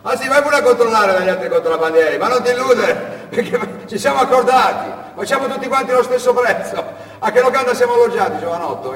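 high, loud scoffing smuggler voice; the fat smuggler laughing at the boy; mocking smuggler. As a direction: sneer at him from up high.

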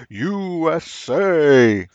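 slow, high, hammed-up Mister USA; mock announcer voice. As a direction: drawl it like a ring announcer. Mocking patriotic wrestler gimmick.

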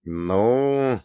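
pensive, drawn-out hesitation; slow, unsure filler; thoughtful drawl. A narrator's reading. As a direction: stretch the word while thinking. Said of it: "задумчиво протянул я".

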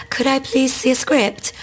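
high, polite, nervous asking; timid younger self. Retold or imitated. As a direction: ask it small and unsure. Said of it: "and I was like".

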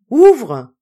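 high, loud one-word command; sharp order. A narrator's reading. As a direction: order it open at once. Wife orders husband to open.

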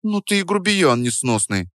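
put-on high, girlish huff; mildly offended high-pitched voice; pouty offence. A narrator's reading. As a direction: say it hurt, with a pout. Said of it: "обиделась Дарья, надув губки".

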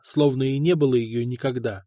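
usual speaking voice

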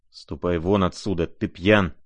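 ordinary delivery, nothing marked

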